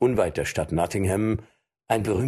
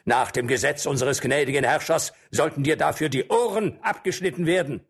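threatening; 6 semitones higher than usual. Acted. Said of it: "rief ein anderer".

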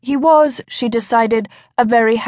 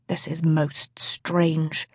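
low, slow, much softer mutter; 4 semitones lower, 8 dB quieter, much slower than usual. A narrator's reading. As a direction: mutter it to yourself, puzzled. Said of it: "he muttered".

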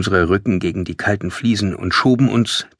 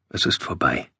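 soft, low, barely voiced; hushed inner thought; 8 semitones lower, 7 dB quieter than usual. A narrator's reading. Narrator thinks all is lost, hushed and low. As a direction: think it aloud under your breath. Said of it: "dachte ich".

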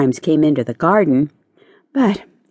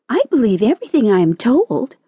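high, eager little caterpillar; hasty protest; 7 semitones higher, faster than usual. A narrator's reading. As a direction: put in a quick, mild protest. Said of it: "observed the caterpillar hastily".

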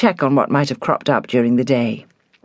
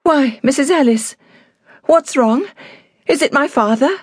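high, anxious questioning; alarmed; 11 semitones higher than usual. A narrator's reading. Daughter asks housekeeper about her father, alarmed. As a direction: ask in rising alarm, much higher. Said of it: "A wave of fear swept over her".

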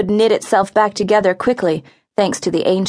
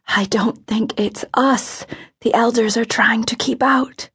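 frightened whisper; 3 semitones higher than usual. A narrator's reading. Hoku warning Aluna of what is above her, whispering. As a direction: breath-only whisper. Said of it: "he whispered again".

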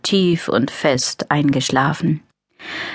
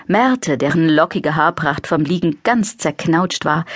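ordinary delivery, nothing marked

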